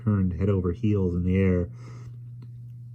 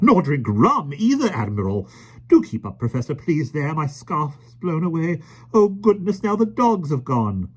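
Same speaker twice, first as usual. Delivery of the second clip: high, fluttery flustered lady; fussy lady voice; 9 semitones higher, 3 dB louder than usual. A narrator's reading. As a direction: dither about scarf and dogs. Miss Lark flustered.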